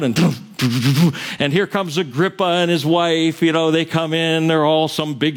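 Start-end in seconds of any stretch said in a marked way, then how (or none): 0.2-1.3 sputtered mock trumpet fanfare; trumpet imitation. Preacher imitating trumpets at a king's grand entrance, mock fanfare. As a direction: toot out a fanfare with your mouth. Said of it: "the trumpets out".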